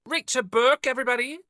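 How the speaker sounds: loud, high, playful shouting; excited shout. Real, not acted. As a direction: call it out to the whole room.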